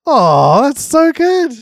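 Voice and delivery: gushing, high and loud; mock delight. Friend thanking Ollie for takeaway money, gushing. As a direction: overdo the thrilled thank-you.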